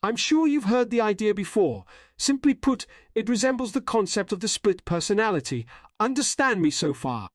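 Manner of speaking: high-pitched, composed mystery figure; cloaked figure explaining itself. Acted. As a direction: explain patiently in a high character voice. Voices the cloaked figure Boogie Pop.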